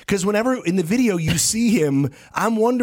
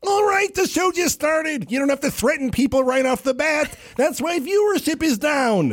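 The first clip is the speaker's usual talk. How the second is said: Vizzini scolding; 12 semitones higher than usual. Retold or imitated. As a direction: scold the co-host from up high. Vizzini scolding co-host on air.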